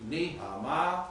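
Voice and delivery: "ni ha ma" pitched high, like Chinese tones; tonal syllables. Shown as a demonstration. Teacher illustrating tonal language like Chinese, tonal syllables. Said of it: "these were tonal".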